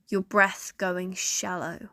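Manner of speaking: usual speaking voice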